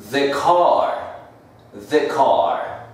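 "the" with a short vowel before car; "the" as "thuh". Shown as a demonstration. Teacher showing the article before a consonant, "the" as "thuh". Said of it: "Como la siguiente es consonante".

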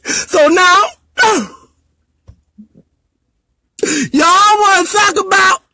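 real sobbing, shouting high through tears; crying rant. Real, not acted. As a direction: yell your hurt out through the crying. Breaks down crying while ranting online.

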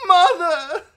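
very high, loud wail for help; pained wail. A narrator's reading. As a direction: wail out like a hurt child. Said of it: "he wailed".

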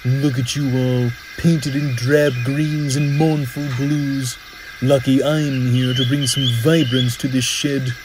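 sneering, self-satisfied engine voice; boastful. Acted. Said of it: "wouldn't stop boasting about himself".

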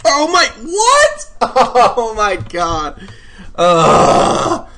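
high, loud, stretched-out outburst; shocked screaming. Real, not acted. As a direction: scream in disbelief, ending in a loud groan. Dies in game, shocked.

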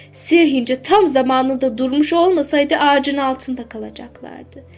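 ordinary delivery, nothing marked

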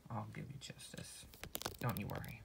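soft whisper to the dead; whispered promise to dead brother; whispered vow. Acted. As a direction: promise it under your breath.

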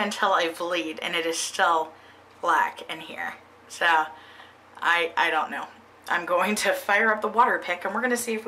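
lip pulled down by finger; speaking while showing gums; finger in mouth. Real, not acted. Seen 1: No floss seen; finger pulls lip down to show gums.

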